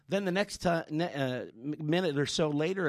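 ordinary talk

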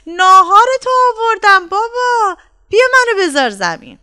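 high, loud call across a field; little boy's ringing shout, easing slightly at the end; child shouting to father. A narrator's reading. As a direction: holler to dad from far away. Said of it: "و داد زد".

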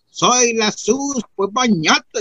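high, drawn-out woman's voice; slow, high-pitched call from the river. Retold or imitated. Woman in the river to Simplicio, high calling voice. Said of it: "me sale una mujer y me dice".

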